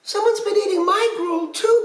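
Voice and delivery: put-on very high little pirate baby; squeaky-high storybook baby voice; piping baby voice. A narrator's reading. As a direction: pipe up like an indignant small child. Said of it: "Baby piped up".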